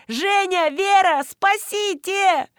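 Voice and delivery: high calls stretched into a long wail; panicked cry. A narrator's reading. Woman carried off by runaway shoes, crying out. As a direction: call desperately for rescue, very high. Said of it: "закричала я".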